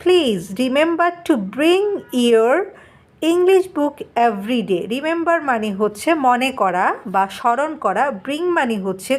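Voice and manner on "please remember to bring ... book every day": very slow, word by word English reading; slow dictation read. Shown as a demonstration. Teacher reading the textbook to students, very slowly. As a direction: read each word out so the class can follow. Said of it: "তখন teacher আবার বলছে".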